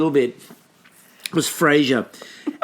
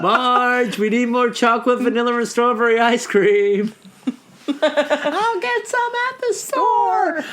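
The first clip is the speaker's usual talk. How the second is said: voicing a sitcom couple; 7 semitones higher, slower than usual.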